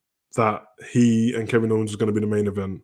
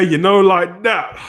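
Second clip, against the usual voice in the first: much higher, slow and loud catchphrase; drawn-out catchphrase; 9 semitones higher, 5 dB louder, slower than usual. Acted. Streamer closes the stream, drawing out "you know, like that". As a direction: stretch out "you know, like that" as the signature line. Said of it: "drawn-out closing catchphrase".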